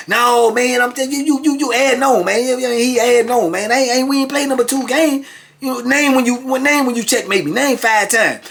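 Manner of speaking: very high, stammering protest; heated mock argument. Beaten chess player challenging the winner to prove it, high indignant imitation. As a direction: insist in a high, worked-up voice. Acted quarrel over chess games.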